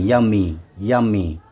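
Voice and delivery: यम्मी ending in a long ee; final y as ee. Shown as a demonstration. Teacher models यम्मी for learners, final y as ee. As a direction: say यम्मी with y sounded as ee. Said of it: "वाई को ई की आवाज़ पढ़ेंगे".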